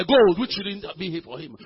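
normal talking voice

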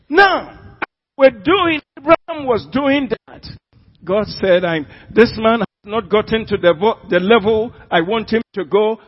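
loud, high pulpit outburst; forceful preacher's voice; full-force preaching. Real, not acted. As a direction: burst out high on "Now", then keep proclaiming loudly.

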